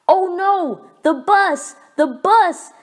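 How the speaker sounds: high, panicky cry; frantic wail over missing the bus; cried out. A narrator's reading. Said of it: "he cried".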